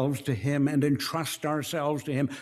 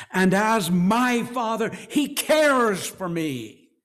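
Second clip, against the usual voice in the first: higher and louder, very slow on cares for me; emphatic, slowing; 8 semitones higher, 6 dB louder, slower than usual. Real, not acted. Preacher urging the congregation that God cares, emphatic and slow. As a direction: lean hard on each word. Preacher stresses God's care.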